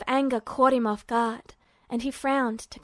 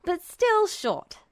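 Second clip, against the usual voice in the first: higher, slow teasing jab; a mock retort to a friend; 7 semitones higher, slower than usual. A narrator's reading. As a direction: tease him about his height. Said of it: "Edward retorted".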